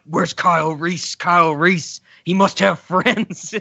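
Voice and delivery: lightly done Batman growl, slow at the end; mock Bale as Batman; a hint of Batman's rasp. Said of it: "doing the Batman voice".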